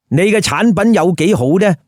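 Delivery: plain reading voice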